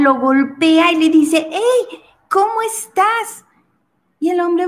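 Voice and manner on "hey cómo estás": llamado alto y agudo, ansiosa; grítale a través del cristal, mucho más aguda; chica llamando. Acted. (la chica golpea el cristal y lo llama, muy aguda)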